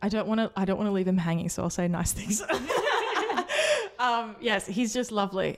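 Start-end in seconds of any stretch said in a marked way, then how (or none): 2.5-3.9 a quick high giggle; high burst of laughter. Real, not acted. Promising nice things about James, she laughs high.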